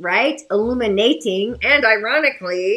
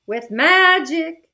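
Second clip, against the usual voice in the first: excited; 7 semitones higher, slower than usual. Real, not acted. Announcing magic Friday.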